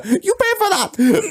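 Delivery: high mock shopkeeper shout after them; shopkeeper yell. Shop owner yelling at thieves, mock high shout. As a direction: bark it like the angry shop owner.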